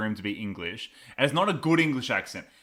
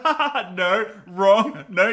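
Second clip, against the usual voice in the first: mock gloating laugh, higher and louder; gloating Whitaker laugh; 7 semitones higher, 7 dB louder, slower than usual. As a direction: cackle the correction at him, much higher. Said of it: "He's like".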